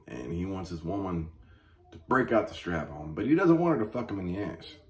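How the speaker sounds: normal talking voice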